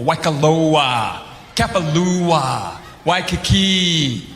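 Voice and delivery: Waikoloa, Kapalua, Waikiki drawn out slow, every vowel lingered on; savoring Hawaiian place names. Shown as a demonstration. A comedian showing off island cadence, slow and savoring. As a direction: roll each name slowly like a local. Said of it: "You pick their cadence".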